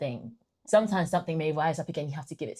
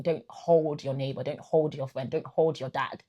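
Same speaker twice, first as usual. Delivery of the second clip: gentle exhortation. Acted. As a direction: urge the listeners, one by one. Preaching exhortation, slow.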